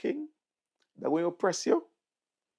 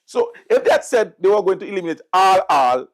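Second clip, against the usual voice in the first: loud mocking emphasis; 4 semitones higher, 9 dB louder than usual. Real, not acted. Mocking the NDP's "all.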